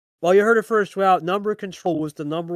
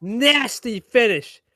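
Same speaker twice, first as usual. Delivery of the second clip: slow savoring call; 5 semitones higher, 6 dB louder, much slower than usual. Real, not acted. Caster savoring finish.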